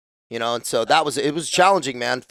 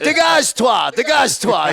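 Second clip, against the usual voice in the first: higher, louder shouted French command; retold French shout; 10 semitones higher, 6 dB louder than usual. Veteran ordering recruits away in French, loud shout. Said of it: "you know, in fucking French".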